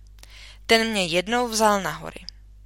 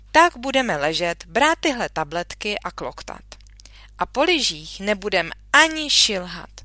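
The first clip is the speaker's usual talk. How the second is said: put-on brisk, loud, teasing doctor checking a feverish girl; jovial country doctor; 5 dB louder than usual. Doctor examining the sick girl, hearty and teasing. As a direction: boom it out like a cheery old doctor.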